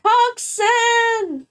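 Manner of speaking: high, bright shout of a viewer's name; excited call-out. Real, not acted. Streamer greeting a viewer who resubscribed, excited high call. Greeting a new subscriber in chat.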